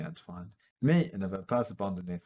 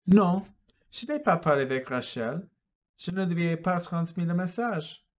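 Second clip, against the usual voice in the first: a touch louder, firm denial; denying; 7 semitones higher, 5 dB louder than usual. Acted. Philippe denying to Antoine, mildly raised. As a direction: insist it wasn't you. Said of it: "Philippe dit".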